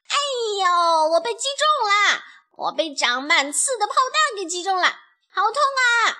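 high, loud hammed-up wailing; howling in pain. A narrator's reading. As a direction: cry out as if stuck full of spines. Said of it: "强盗巴巴痛得逃离了战".

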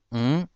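嗯 as a syllabic nasal "ng" on a rising tone; pure nasal. Shown as a demonstration. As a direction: hum 嗯 as Hokkien "n̂g", no vowel. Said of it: "纯粹的鼻音".

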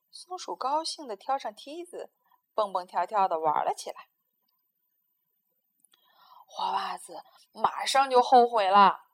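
ordinary delivery

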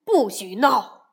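loud, slow father's order; stern shout. Acted. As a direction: bark it to stop the fuss. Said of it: "熊爸爸提高了嗓门喊". (熊爸爸提高嗓门，大声喝止孩子们)

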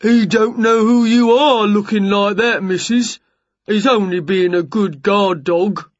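big fellow making excuses; giant neighbour. A narrator's reading. Jerry defending his guard dog to Winnie, explaining.

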